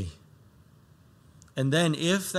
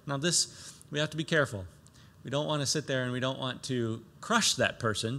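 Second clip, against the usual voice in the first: normal talking voice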